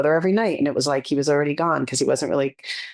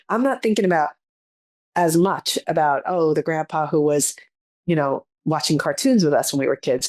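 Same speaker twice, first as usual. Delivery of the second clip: ordinary delivery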